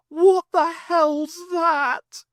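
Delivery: shocked at the sky; high startled shout; alarmed. Acted.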